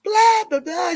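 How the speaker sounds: high, loud, slow hammed-up yelling; loud mock tantrum with a bleeped curse; mock furious rant. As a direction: play an outraged star losing it. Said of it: "She lost her mind".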